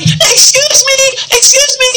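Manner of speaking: very high-pitched interrupting character; comic character voice. Acted. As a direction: butt in with a squeaky-high put-on voice. Cohost butting in as comic character.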